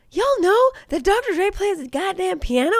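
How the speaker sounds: high-pitched, laughing excitement; excited reveal. Real, not acted. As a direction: spill the spoiler, high and giddy. Excited spoiler reveal to co-host.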